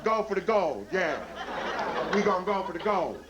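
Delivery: a party team whooping it up; rowdy cheering athlete. Retold or imitated.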